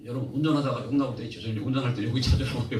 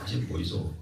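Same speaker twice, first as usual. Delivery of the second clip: lower, softer Gyeongsang accent; Gyeongsang dialect; 6 semitones lower, 5 dB quieter than usual. Shown as a demonstration. Preacher repeating the regional phrase for listeners, Gyeongsang accent. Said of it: "경상도는".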